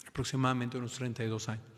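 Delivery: normal talking voice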